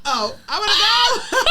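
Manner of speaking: high laughing whine; laughing wail. Real, not acted. Co-host as the episode wraps, wailing with laughter.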